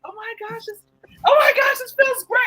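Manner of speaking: high delighted exclamation; high, hammed-up delight; ecstatic. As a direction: squeal with pleasure. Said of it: "she's like".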